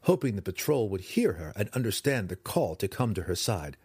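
usual speaking voice

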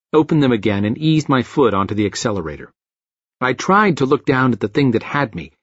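ordinary delivery, nothing marked

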